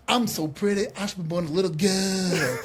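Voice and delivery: flamboyant strut-up-to-the-mic catchphrase, drawled out; Dusty Rhodes. Retold or imitated. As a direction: show him how it's done, with swagger. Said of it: "a flamboyant Dusty was".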